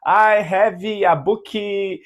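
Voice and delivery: mock a beginner's clumsy Brazilian-accented English; a Brazilian who has never spoken English stumbling through a sentence; bad Brazilian English. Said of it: "você vai ver que você tá falando".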